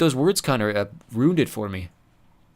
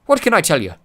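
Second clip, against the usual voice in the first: louder, higher dwarf shrug; no regrets at all; higher, cocky unapologetic dwarf; 7 semitones higher, 7 dB louder than usual. Acted. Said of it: "said Mulch unapologetically".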